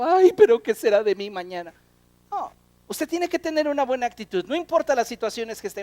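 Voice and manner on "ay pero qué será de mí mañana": loud mock lament; hammed-up fretting, opening on a wailed "Ay"; worried wailing. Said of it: "preocupando y llorando y diciendo".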